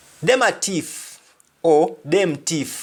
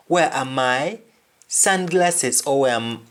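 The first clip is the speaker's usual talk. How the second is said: ordinary talk, nothing marked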